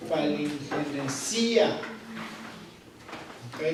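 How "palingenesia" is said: "palingenesia" said slowly, stress on the i. Shown as a demonstration. Teacher drills the Greek word for students, slow, stressed on the i. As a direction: spell it out for the class: "palingenesia", weight on the i. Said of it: "con acento en la I".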